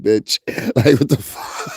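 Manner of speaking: normal talking voice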